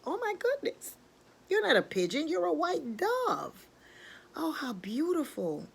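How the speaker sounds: high exclamation settling into wonder; amazed delight. Acted. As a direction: marvel at a lovely discovery.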